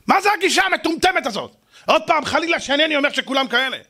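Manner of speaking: high, heated preaching; indignant rebuke. Real, not acted. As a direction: scold the caregivers with fire, high. Rebuking harsh caregivers. (מוכיח בלהט, בקול גבוה)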